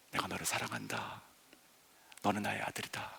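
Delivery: father tenderly tells his son he is loved; tender declaration. Acted. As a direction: speak it like a quiet blessing.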